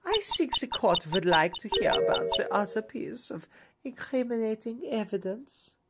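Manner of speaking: put-on menacing whisper in a German accent. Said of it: "a German accent instead of Russian now".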